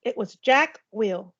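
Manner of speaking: ordinary delivery, nothing marked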